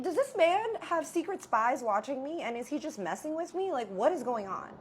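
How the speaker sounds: high, loud, slowed disbelieving rant; exasperated rant. Retold or imitated. Complaining to brother about elusive dad, high exasperated rant. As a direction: throw your hands up at the absurdity. Ranting about dad's vanishing act.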